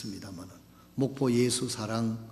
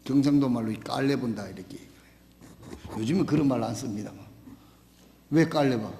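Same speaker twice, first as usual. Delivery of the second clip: ordinary talk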